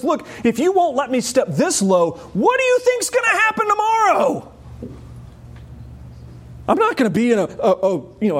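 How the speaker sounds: rising, high, urgent questions; impassioned challenge. Retold or imitated. Preacher voicing Jesus challenging Peter, high and urgent. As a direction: press Peter with mounting intensity.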